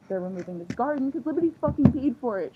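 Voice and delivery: worked-up fan venting loudly; angry rant. Real, not acted. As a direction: fume out loud about it. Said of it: "I'm so annoyed".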